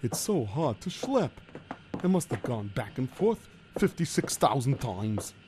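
put-on worried Yiddish-accented kvetching. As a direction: complain like a hapless schlemiel. Figure complains.

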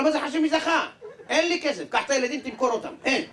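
loud, high, desperate yelling; frantic angry shout. Acted. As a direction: yell that you have nothing to give. Man refuses, no money. (צעקה נואשת וגבוהה)

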